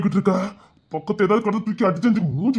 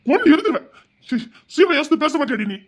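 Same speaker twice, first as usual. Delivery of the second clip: angry scolding; 7 semitones higher than usual. Acted.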